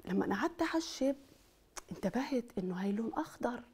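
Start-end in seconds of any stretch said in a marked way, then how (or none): 1.9-3.6 unvoiced secretive whisper. Real, not acted. Whispered aside in lesson.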